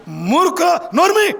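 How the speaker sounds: preacher shows what an angry retort sounds like; mock angry rebuke. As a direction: bark it like a furious husband. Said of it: "అనేది కోపం".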